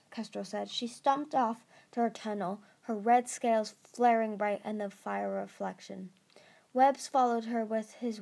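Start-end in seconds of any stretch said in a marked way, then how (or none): none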